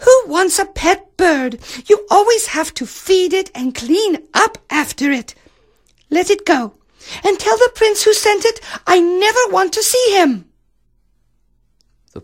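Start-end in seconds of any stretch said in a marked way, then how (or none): 0.1-10.5 very high, petulant princess voice; spoiled princess complaining in a squeak; haughty princess. Acted. Princess spurns the prince's bird, high haughty voice.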